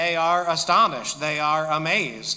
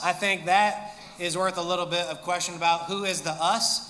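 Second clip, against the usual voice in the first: ordinary delivery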